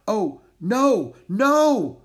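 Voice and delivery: loud, high, mounting alarm; dismayed cry. A narrator's reading. Musher finding the medicine gone, dismayed cry. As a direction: cry out at a terrible discovery.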